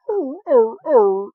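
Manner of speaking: high whimper like a scared puppy; whimpering dog whine. Retold or imitated. Retelling the movie, Charizard cowers from Gyarados in high whimpers. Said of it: "ears down and everything".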